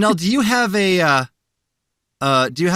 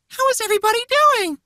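Elmo voice; much faster than usual. Retold or imitated. Voicing Elmo's tweet.

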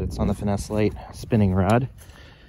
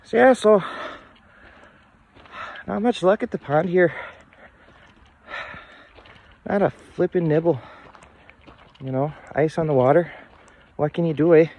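hammed-up Canadian accent, higher and louder; bad Canadian accent; 9 semitones higher, 3 dB louder than usual. Vlogger wraps up the frozen pond in a bad Canadian accent. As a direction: put on a goofy Canadian accent. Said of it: "It's a really bad Canadian accent".

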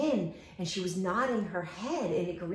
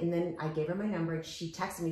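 ordinary talk, nothing marked

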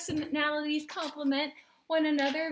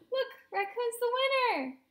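high, delighted exclamation; excited; 10 semitones higher than usual. Pretend. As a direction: show the children the thrilling news. Reader delights at raccoon's win.

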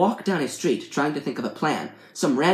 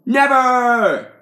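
loud, high, drawn-out yell; hammed-up defiant shout; shouted refusal; 11 semitones higher, 9 dB louder than usual. A narrator's reading. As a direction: bellow it like an over-the-top villain.

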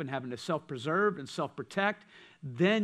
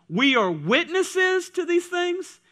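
high, slow, ringing declaration; declaiming scripture to the congregation; bold proclamation; 13 semitones higher, 10 dB louder, slower than usual. Acted. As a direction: stretch it out like a proud testimony. Proclaims apostles' reply from scripture.

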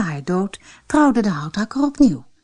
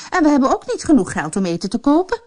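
stepmother; 7 semitones higher, faster than usual. Acted. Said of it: "zei de vrouw".

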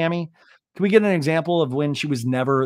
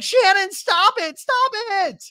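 high, slow pleading whine; mocking Tamara; 3 dB louder, slower than usual. Tamara begging Shannon to stop, high whiny voice. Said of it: "Tamara's like".

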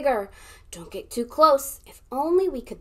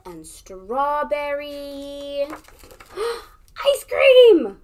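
excited; 4 semitones higher, 9 dB louder, much slower than usual. Acted. Excited gasp mid treat.

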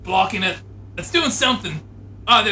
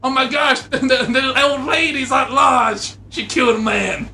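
raised, urgent voice; acted alarm; 6 semitones higher, 4 dB louder than usual. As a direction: sound the alarm with comic urgency. Alarm at escaped old lady.

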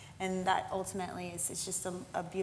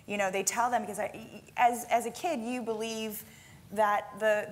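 ordinary talk

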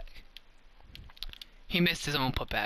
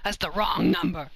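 gloating; 3 semitones higher, 4 dB louder than usual. Real, not acted. Taunting catchphrase after psych.

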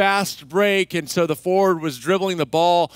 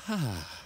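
soft imitated sigh of relief; relieved exhale; 6 semitones lower, 13 dB quieter than usual. Boy carried off by grandpa, soft relieved sigh. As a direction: let the breath go softly. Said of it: "And I just went".

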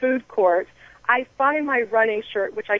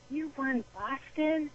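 much softer, slow and hushed with wonder; awed kid; 5 semitones higher, 12 dB quieter, slower than usual. Retold or imitated. A young kid to the marathon winner, in a much softer, slow, awed hush. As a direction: ask it like a starstruck child.

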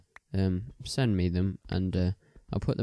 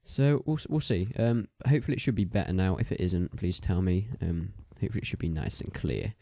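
ordinary delivery

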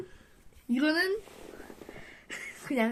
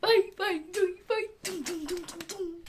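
high cutesy bye chant, fading; breathy cutesy giggly; 6 semitones higher than usual. Acted. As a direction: sing the goodbye in a cute voice. Playful sign-off chant.

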